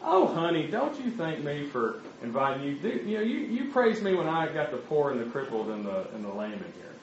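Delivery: put-on lilting Southern accent, trailing down softly at the end. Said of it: "she has a real Southern accent".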